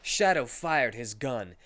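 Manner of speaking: ordinary delivery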